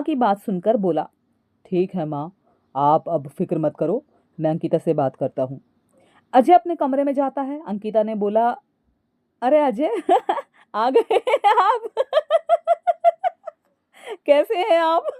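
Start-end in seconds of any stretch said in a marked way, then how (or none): 1.7-5.6 low-pitched male character voice; husband, composed. A narrator's reading. 9.4-15.1 high, helpless laughing greeting. A narrator's reading. Laughing wife greets her husband.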